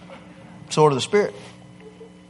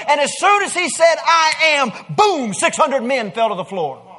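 preaching at full force; 9 semitones higher than usual. Acted. Preacher to congregation retelling Jesus's arrest, full-force preaching. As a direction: preach it out, building to the boom.

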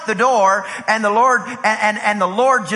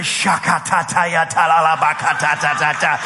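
mock speaking in tongues; run-on imitation of Pentecostal tongues; imitated tongues; 3 semitones lower, slower than usual. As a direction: rattle off percussive nonsense syllables. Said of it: "I start hearing".

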